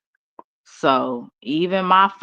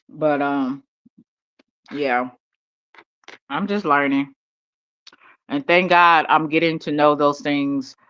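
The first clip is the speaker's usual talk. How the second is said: chewing noisily; 2 semitones lower than usual. Real, not acted. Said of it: "I'm sorry I'm eating".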